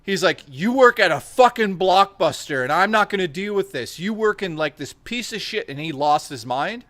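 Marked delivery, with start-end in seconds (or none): 0.5-5.7 imitated angry rant, pitched up; mad customer. Caught-out customer berating the video store clerk, imitated mad rant. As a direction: retell his tirade, higher and heated. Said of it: "he got so mad".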